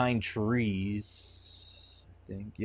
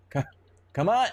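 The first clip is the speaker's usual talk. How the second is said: high loud exasperated call; frustrated shout; 11 semitones higher, 7 dB louder than usual. Real, not acted. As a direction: shout it out, fed up. Calling companion, more frustrated.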